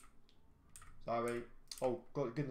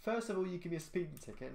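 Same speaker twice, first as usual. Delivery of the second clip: pitched up, half-joking complaint at the cop; mock indignation; 7 semitones higher, faster than usual. Driver grumbling at the in-game cop over the ticket, mock indignant. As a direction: pretend outrage, voice jumping high.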